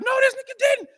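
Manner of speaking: outraged sister. Retold or imitated. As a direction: voice her disbelief up high. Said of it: "The sister's like".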